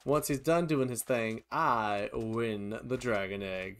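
ordinary talk, nothing marked

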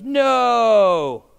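high, loud, long-drawn NO; drawn-out shouted kids' refusal; children answering in chorus. Acted. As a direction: holler it like a whole class of kids. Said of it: "Again, the answer was".

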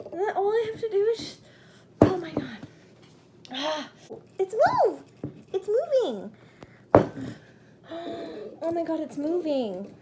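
high, loud shocked gasps; startled shrieking. Real, not acted. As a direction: shriek as it gives way. Handstand attempt, startled.

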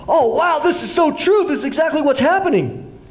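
high, bright-eyed discovery; excited realization. Retold or imitated. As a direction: gasp it like a sudden revelation. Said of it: "I was like".

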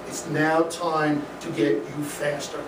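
ordinary delivery

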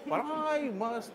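high, drawn-out excuse while backing away; stretched, high-pitched mock regretful exit. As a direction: imitate someone edging out of a commitment. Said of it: "he's doing one of those sort of backing out".

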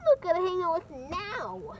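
usual speaking voice

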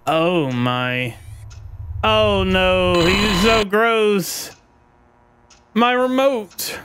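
high, slow frightened whine; scared horror game streamer. Real, not acted. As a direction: recoil in disgust and fear.

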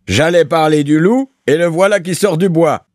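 high, loud jeering exclamation; exclaimed cry. A narrator's reading. Landlord greeting the bailiff who appears, exclaiming loudly. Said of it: "s'écria Gorgerin".

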